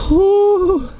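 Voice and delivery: loud, high "woo"; high, drawn-out holler; excited whoop. Real, not acted. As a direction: whoop loudly at the result. Reacts to the shot's damage.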